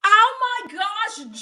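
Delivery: high, loud, drawn-out delighted outburst; real excitement. Real, not acted. Streamer seeing a viewer name a loved movie, excited. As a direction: burst out thrilled at the mention. Thrilled by a childhood movie mention.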